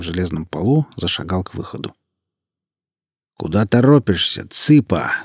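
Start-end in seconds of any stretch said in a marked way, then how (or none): none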